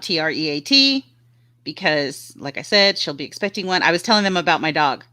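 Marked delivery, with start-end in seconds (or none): 0.0-1.1 T R E A T spelled letter by letter. Shown as a demonstration. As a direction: spell out T R E A T, one letter at a time. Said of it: "I have to spell out".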